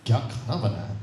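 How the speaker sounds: very low, falling question; second way of asking. Shown as a demonstration. Teacher repeating the three tones, low and falling. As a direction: keep it low and let it fall. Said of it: "ये तो वैसे ही हो गया".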